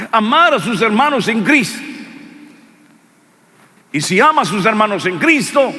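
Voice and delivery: high, loud pulpit preaching; fervent loud sermon voice; preaching at full force. Acted. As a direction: preach it out to the congregation.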